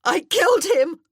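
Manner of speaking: a wild, high, loud scream of guilt; yelled confession. Acted. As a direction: lose control and yell it at him. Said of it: "she yelled the words at him".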